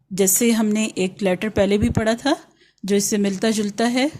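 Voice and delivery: ordinary delivery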